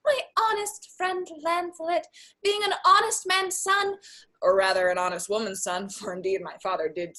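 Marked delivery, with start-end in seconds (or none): 0.1-4.0 high-pitched little voice of conscience; put-on high voice giving a warning; conscience's voice. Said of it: "my conscience hanging about the neck of my heart says very".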